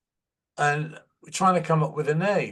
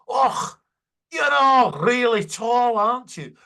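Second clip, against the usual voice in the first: put-on Scottish accent; 9 semitones higher, 6 dB louder than usual. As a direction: do a tiny Scottish cleaning lady's surprised question in broad Scottish. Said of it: "that's a good Scottish accent".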